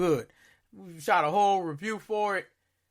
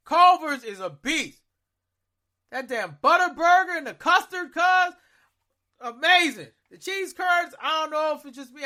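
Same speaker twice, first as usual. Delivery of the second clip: higher and louder, hyped up; excited rave; 10 semitones higher, 6 dB louder than usual. Real, not acted. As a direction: rave about it like a fan. Hyped food praise.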